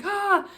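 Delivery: imitating dad's high, loud startled ah; startled yell.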